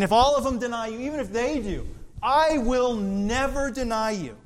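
Peter insisting. Acted. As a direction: insist it won't happen. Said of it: "And Peter's saying".

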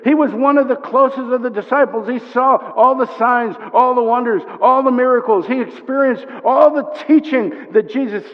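high, loud, insistent sermon voice; impassioned preaching. Real, not acted. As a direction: press every phrase on the congregation. Preaches at full force.

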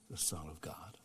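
hushed whisper. Acted. Pastor ending the appeal, whispered. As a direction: let it fade to a whisper.

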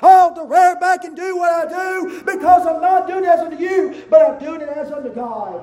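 loud, driving sermon cadence; full-force preaching. Acted. Pastor exhorting the church, full force. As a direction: rear back and preach it.

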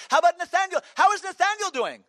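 very high, loud, eager question; Brother Vic. Retold or imitated. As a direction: imitate an effervescent pastor shouting his question. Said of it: "he talked like that".

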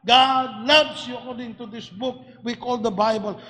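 ordinary delivery

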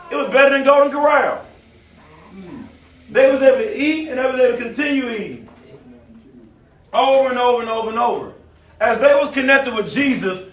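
rising pulpit cadence; preaching. Acted. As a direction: preach it to the pews with fire. Preaching at full force.

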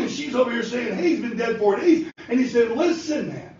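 high, ringing preaching voice; fervent preaching. Acted. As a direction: preach the scene with fire. Preaches the raising of Lazarus.

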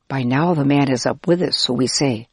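ordinary delivery

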